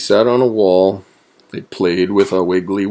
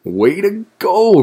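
high, loud cheer; cheering cry; 11 semitones higher, 5 dB louder than usual. A narrator's reading. Wombat and Woodchuck applaud Weasel, cheering. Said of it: "cried Wombat and Woodchuck".

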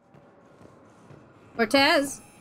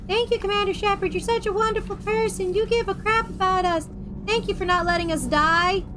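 squeaky, fawning crew voice, pitched higher; high mock gratitude; 6 semitones higher than usual. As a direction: gush thanks like an adoring crewmate. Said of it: "voicing crew thanking Shepard".

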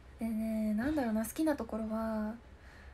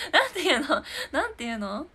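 ordinary talk

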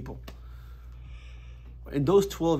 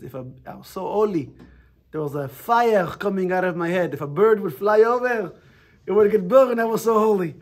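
put-on higher and louder, boastful Israeli accent; 6 semitones higher, 9 dB louder than usual. Friend's father boasting to the class, in an Israeli accent. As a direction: puff up in a thick Israeli accent, proud of your holiness. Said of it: "in his very Israeli accent".

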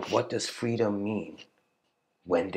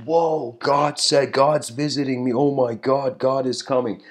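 higher and louder, gushing with excitement; thrilled; 4 semitones higher, 7 dB louder than usual. Retold or imitated. Woman waking from her dream, thrilled God is coming. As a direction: burst out in giddy disbelief.

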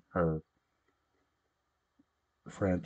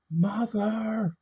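high, loud frantic scream of one word; 4 dB louder than usual. Acted. Daughter screams for her mother behind the door, high and loud. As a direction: cry out for your mother through the door. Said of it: "Mary's voice screamed".

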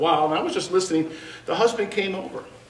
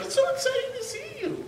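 high, soft gushing voice; mock excited lady; gushing wife; 5 dB quieter than usual.

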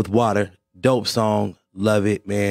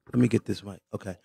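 normal talking voice

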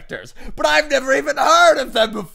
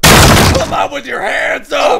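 through a megaphone; 3 semitones higher, 8 dB louder than usual. Acted. Calling out as police.

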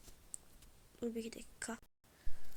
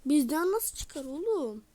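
indignant shout; 2 semitones higher, 7 dB louder than usual. Real, not acted. Indignant at the goal conceded.

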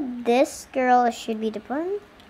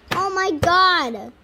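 dramatic exclamation; 5 semitones higher, 5 dB louder, slower than usual. Pretend. Exasperated doll reacts to friend.

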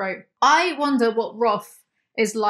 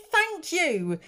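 higher, automatic thank you; polite thank you; 7 semitones higher than usual. Retold or imitated. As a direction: let it slip out reflexively. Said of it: "but I said".

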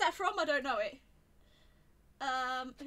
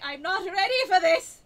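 very loud, higher protest; panicked shout; 5 semitones higher, 16 dB louder than usual. Real, not acted. Gamer under attack and unprepared, shouting in panic. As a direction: yell it as everything piles on.

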